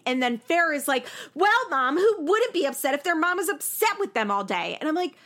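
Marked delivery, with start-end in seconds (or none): 1.3-4.8 put-on snippy teen. Mimics Farrah snapping.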